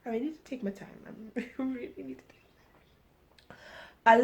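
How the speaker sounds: half-whispered note to self; soft, slowing aside with a laugh; muttered self-reminder. Real, not acted. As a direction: say it under your breath to yourself. Muttered self-reminder while reading.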